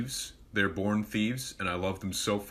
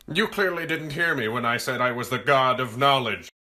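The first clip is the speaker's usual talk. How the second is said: pompous god voice; 4 semitones higher, 6 dB louder than usual. Pretend.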